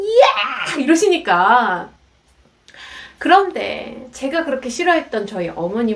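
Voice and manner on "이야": high, drawn-out yell; imitated mother's screech; angry shriek. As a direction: burst straight back into a scolding shriek. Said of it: "또 전화 끊고 나면".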